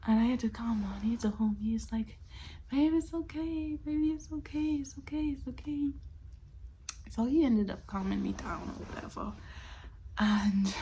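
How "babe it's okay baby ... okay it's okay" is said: put-on comforting boyfriend.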